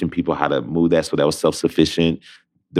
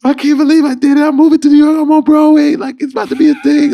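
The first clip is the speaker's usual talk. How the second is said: very high, loud, tearful joy; sob-shout the good news; bawling with joy; 9 dB louder than usual. Retold or imitated. On Skype with a friend in South Korea, bawling with joy. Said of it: "just bawling on the".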